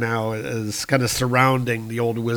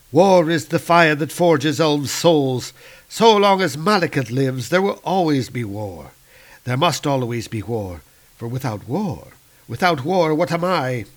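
proud dark elf; 3 semitones higher, 4 dB louder than usual. Acted. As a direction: proclaim it like a creed. Malekith declares creed.

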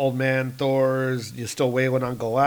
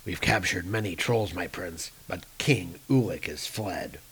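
lower, softer report to a prince; dutiful soldier; 5 semitones lower, 6 dB quieter than usual. Acted. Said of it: "Hogun comes".

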